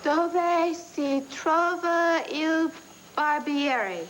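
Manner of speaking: slow, careful Italian phrase; practised Italian. Acted. Practising Italian for the trip, slow careful Italian. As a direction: sound out the Italian word by word.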